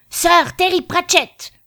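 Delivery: judge's roar. Acted. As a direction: bellow the name as if to a crowd. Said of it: "hurla le juge".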